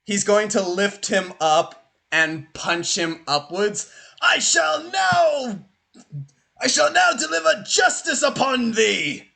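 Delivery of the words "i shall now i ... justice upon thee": righteous Viking king. Acted. Viking king about to punch the bandit, high righteous proclamation. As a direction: proclaim judgement like a storybook warrior king.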